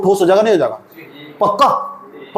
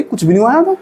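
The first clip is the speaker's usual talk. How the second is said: lightly voiced girl playing innocent; imitated girl covering up; 4 semitones higher than usual. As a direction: mimic a girl hastily covering up, pitched a little up. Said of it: "मैं कह रही".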